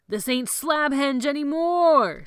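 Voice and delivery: high, loud, slow holler. A narrator's reading. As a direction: holler it across the room. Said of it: "Sebastian hollered".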